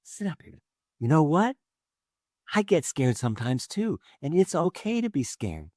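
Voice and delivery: acted tender parent voice; comforting mommy. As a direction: gently reassure a frightened little child. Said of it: "And Mommy says".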